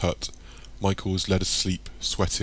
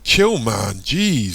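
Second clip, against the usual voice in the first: exasperated; 3 semitones higher, 6 dB louder, much slower than usual. A narrator's reading. Darren telling Michael to chill, loud and exasperated. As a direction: tell him off with exasperation.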